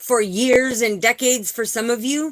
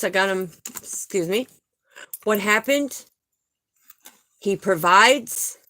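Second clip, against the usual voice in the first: normal talking voice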